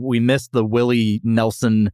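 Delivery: ordinary talk, nothing marked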